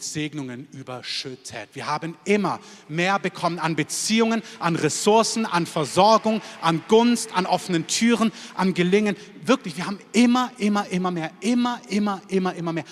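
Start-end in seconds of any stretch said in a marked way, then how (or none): 1.7-12.8 impassioned preaching. Real, not acted. Preacher promising the congregation they always received more, impassioned. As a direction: preach it with rising force, pounding each item. Preaching builds to full force.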